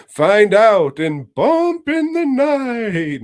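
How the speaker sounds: slow, loud movie-trailer announcer. Acted. Pitching the movie to Joe, mock trailer announcer. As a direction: intone it like a dramatic trailer. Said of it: "movie trailer tagline".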